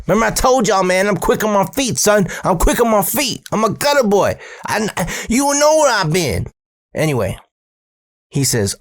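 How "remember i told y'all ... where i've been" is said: high, cocky boast; high-pitched street brag; bragging. Real, not acted. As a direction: crow about being quick on your feet. Teller brags, voice pitched up.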